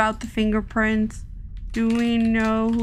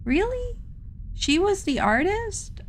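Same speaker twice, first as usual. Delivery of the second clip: surprised; 10 semitones higher than usual. Real, not acted. Hears unexpected news about the artist.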